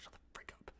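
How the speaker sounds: muttering back at the commenters; whispered retort. Retold or imitated. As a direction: hiss it under your breath. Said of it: "I'm like".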